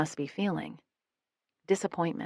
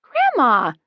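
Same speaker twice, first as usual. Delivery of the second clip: loud, high exasperated huff; shocked, huffing at grandma; huffy; 7 semitones higher, 10 dB louder than usual. A narrator's reading. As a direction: scold her with one huffed word. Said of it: "Abby huffs".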